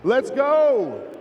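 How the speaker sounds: loud, slow hype announcer; fighting game announcer. Real, not acted. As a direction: call it out to the whole arena. MC calling out to arena crowd.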